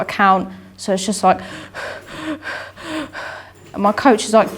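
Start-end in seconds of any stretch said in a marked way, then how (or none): 1.4-3.4 long breathy sigh of defeat; a weary exhale after losing; deflated sigh. Retold or imitated. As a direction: let all the air out, beaten. Knows the fight is lost.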